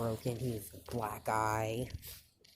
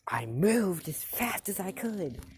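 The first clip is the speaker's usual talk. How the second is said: high-pitched, acted yell back; loud yell; 8 semitones higher, 3 dB louder than usual. Deku answering back after the smack, yelling. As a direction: yell it in protest, not at full volume. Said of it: "he yells loudly".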